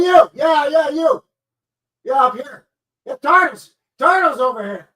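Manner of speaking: high, loud shouting to get attention; calling out. Acted. Streamer hollers at chatter Turtle as if across a crowd, high and loud. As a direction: yell across a crowd to wave someone over.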